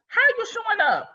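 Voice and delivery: sudden high-pitched rapid repeat; high quick question. Shown as a demonstration. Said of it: "a simple question".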